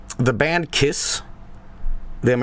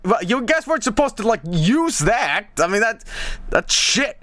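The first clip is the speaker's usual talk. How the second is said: much higher, half-laughing complaint; exasperated protest; 10 semitones higher than usual. Retold or imitated. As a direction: squeak out a mock protest. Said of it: "I'm like".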